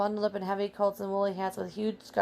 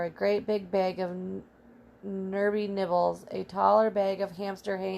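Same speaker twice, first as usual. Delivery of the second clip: usual speaking voice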